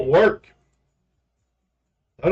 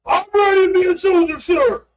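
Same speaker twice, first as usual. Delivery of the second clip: high, loud soldier shout; eager recruit; 4 dB louder than usual. Pretend. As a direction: yell it like a fresh cadet. Playing eager recruit in boot camp.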